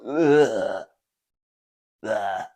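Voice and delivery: loud fake retching groan; mock sick noises. Friend heckles comedian with mock sick noises. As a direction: imitate someone making disgusted sick noises. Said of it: "Starts making sick noises".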